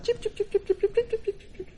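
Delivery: high-pitched imitated bird chirps; bird chirping. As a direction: chirp rapidly like a little bird. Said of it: "Bird you can understand".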